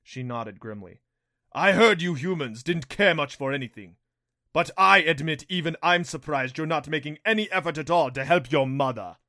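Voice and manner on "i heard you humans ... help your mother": loud, raised villain voice; mock them loudly with relish; taunting Rompo beast. A narrator's reading. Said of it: "The Rompo chuckled".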